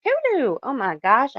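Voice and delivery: high, loud cheer; high joyful call; excited whoop. Real, not acted. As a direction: whoop a greeting to a friend. Shout-out greeting to a chat member.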